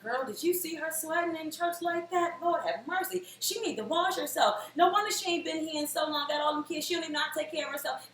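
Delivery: mock scandalized congregation busybody; gossipy church lady. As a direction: tut over her like a church gossip. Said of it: "church people, they're lovely. But some of them".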